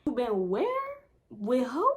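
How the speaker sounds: mock-baffled questions, sharp upward swoop; lightly hammed-up bewilderment, rising at the end; owl-like questioning. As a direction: hoot each short question like a puzzled owl. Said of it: "you would've thought I was a motherfucking owl".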